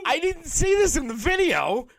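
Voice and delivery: high mock panicked clerk; high, thin clerk who has no checklist. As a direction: squeak like a lost new hire. Mocking the clerk.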